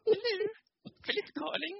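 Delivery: high posh telephone greeting; posh phone voice. Retold or imitated. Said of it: "That's his posh phone voice".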